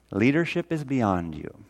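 ordinary talk, nothing marked

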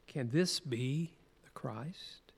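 slow, half-whispered question; hushed wonder. Acted. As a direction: ask it softly and slowly, in awe. Quotes the woman's amazed question.

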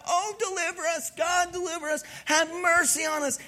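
high-pitched mock pleading; crying out. Israelites praying to God for rescue, high imploring cry. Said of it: "they cried out to God".

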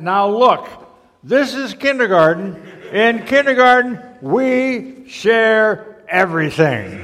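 loud, high schoolroom chant with a very slow finish; big storybook chorus, loud and stretched; all the kids, chorusing. A narrator's reading. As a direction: chant the rule like a whole class, dragging out the last words. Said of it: "And all the kids said".

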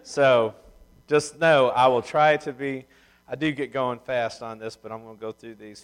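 usual speaking voice

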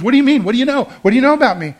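retold alarm, rapid-fire demands; horrified. Woman confronting her pastor, horrified and loud. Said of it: "she was horrified".

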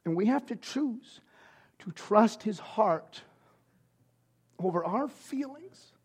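soft slow refrain. Real, not acted. Quiet repeated refrain.

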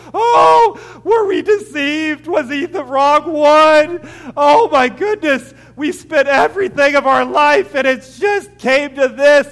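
loud, high wailing lament, partly slow; crying disciples. Acted. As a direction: cry out as the lost disciples. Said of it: "They're crying".

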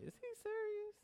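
high, disbelieving squeak of a doubter; incredulous listener. Retold or imitated. Congregant doubting the preacher, high incredulous squeak.

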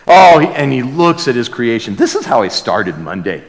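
usual speaking voice

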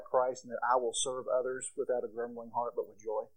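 soft, low voice of someone confiding a prayer request; pretend prayer requester. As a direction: play a humble, hushed request to the group. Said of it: "and here's what they say".

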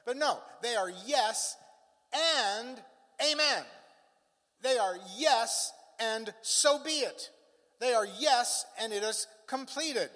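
"yes" and "amen" punched high and loud; slow, with pauses setting off "amen"; emphatic. Shown as a demonstration. Preacher driving home the promises, emphatic high "yes" and "amen". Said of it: "the word is so emphatic".

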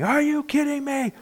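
high-pitched mock yell, held back in volume; mock scream. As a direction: imitate a parent losing it at the kids. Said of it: "yelling, screaming".

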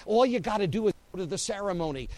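unsaved friends urging them to give in, fast and breezy; tempting friends. Acted. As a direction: brush it off as easy.

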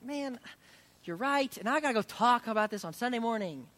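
high, loud burst of owning up; emphatic retold admission. Preacher retelling his reply to friends, emphatic admission.

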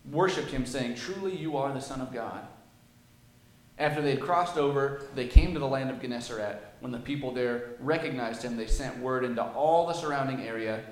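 loud, measured Bible reading to the church; scripture reading. Acted. Preacher reading the gospel aloud to his church. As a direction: read the passage out with weight.

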